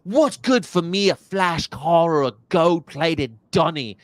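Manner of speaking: hint of an Aussie larrikin; Steve Irwin impression. Mocking Steve Irwin's quote, lightly Australian. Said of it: "he's like".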